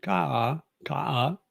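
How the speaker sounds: "ka" going up, then down; "ka" rising first, then falling; "ka" as a rise-fall Thai tone demo. Shown as a demonstration. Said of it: "the other way".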